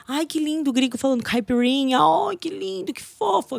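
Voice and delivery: high mocking coo over a foreigner. Mocking Brazilians gushing over a foreigner, high sugary coo. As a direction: gush at the gringo in a sugary high voice.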